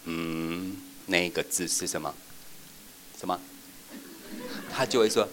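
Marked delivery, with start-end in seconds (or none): 0.1-3.4 hinting prompt. Shown as a demonstration. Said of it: "你不能这样".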